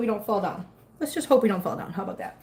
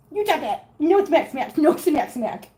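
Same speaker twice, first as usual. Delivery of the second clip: higher mock-stern voice; 4 semitones higher, slower than usual. Real, not acted. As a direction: scold a kitten, cutely. Playfully scolding a biting kitten.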